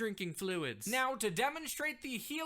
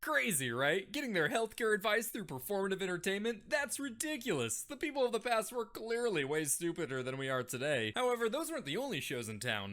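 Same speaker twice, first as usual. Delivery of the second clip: ordinary talk, nothing marked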